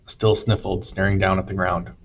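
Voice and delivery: plain reading voice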